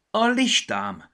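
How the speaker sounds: high, loud, slow exclamation; high, loud cry of remembering; sudden realization. Acted. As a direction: remember something all at once. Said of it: "csapott a homlokára".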